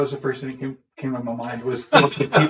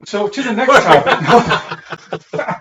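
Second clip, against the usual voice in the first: slow, laughing brush-off, pitched up and louder; laughing dodge; 9 semitones higher, 9 dB louder, much slower than usual. Real, not acted. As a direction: laugh through it and change the subject. Jokey question about surviving friendship.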